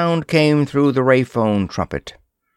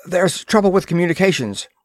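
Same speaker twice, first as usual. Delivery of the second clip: gasping fast; 8 semitones higher, faster than usual. A narrator's reading. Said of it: "he gasped".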